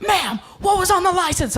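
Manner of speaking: high, urgent police challenge; alarmed cop. Retold or imitated. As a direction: demand it like a jumpy officer. Cop with hand on gun demands.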